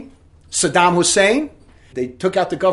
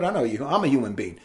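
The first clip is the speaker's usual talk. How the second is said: usual speaking voice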